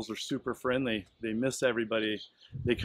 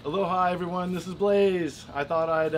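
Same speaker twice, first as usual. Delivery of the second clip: shouting over wind; 5 semitones higher, 5 dB louder than usual. Real, not acted. Greeting outdoors at windy beach.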